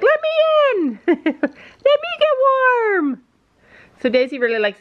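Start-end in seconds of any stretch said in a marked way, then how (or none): 0.0-3.2 very high, slow begging puppy voice with a laugh; high giggly pup voice; voicing the dog. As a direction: beg to get in like a little dog. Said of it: "Daisy's like".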